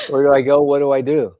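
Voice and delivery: exclaimed mock desperation; mock panic. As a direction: call it out as a lost soul. Acting out being lost in life.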